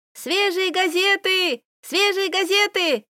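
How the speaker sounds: put-on high, slow street cry of a paper boy; newsboy calling out. A narrator's reading. Paper boy hawking newspapers to passersby, high and drawn out. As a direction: call out your papers to the street.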